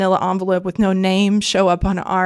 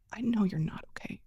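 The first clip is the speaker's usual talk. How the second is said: gentle hushed concern; 13 dB quieter than usual. Retold or imitated. Family member gently consoling Steph.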